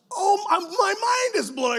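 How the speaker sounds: high, loud, slowed exclamation; mind blown. Real, not acted. Pastor telling the congregation his mind was blown, shouting. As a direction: shout your amazement.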